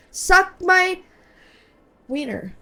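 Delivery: yelled high and loud. Real, not acted. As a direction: scream the insult up high. Shouting insult at game character.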